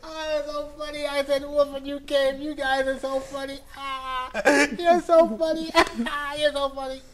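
very high laughing voice; hammed-up giggly mockery; amused prankster. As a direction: play the boy weak with laughter at his victims. Boy laughs at fooled villagers.

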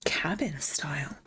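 low whispered words; hushed reading. Real, not acted. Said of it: "whispers the style name".

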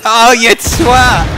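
slow, high, loud "oh, you twat"; drawn-out outburst. Real, not acted. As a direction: let it out, stretched. Killed by teammate, outburst.

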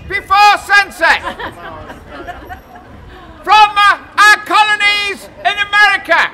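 loud proclaiming greetings; town crier. Acted. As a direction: greet each nation like a crier.

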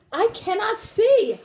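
high, slow storybook wail; distressed cry. A narrator's reading. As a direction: draw it out in acted distress. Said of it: "cried Toad".

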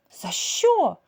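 high, loud startled question; surprised cat. A narrator's reading. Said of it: "здивовано спитала Кілька". (здивовано високим голосом)

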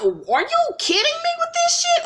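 very high, shocked squeal; high-pitched stunned outrage; outraged disbelief. Real, not acted. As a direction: shriek it in disbelief. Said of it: "Like, what?".

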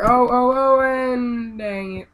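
high loud drawn-out groan of defeat; frustrated yell. Real, not acted. Gamer crashing his buggy, loud drawn-out groan. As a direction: wail as the jump goes wrong.

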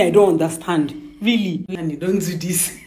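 usual speaking voice

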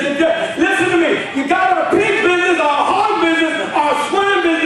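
high, loud shouting at Jesus; furious crowd wanting Jesus gone; angry townsfolk. Acted. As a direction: yell him out of town.

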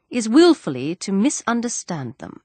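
ordinary delivery, nothing marked

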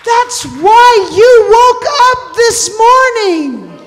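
loud, high, slow proclamation; full-force preaching. Acted. Preacher telling the church why they woke, full-force preaching.

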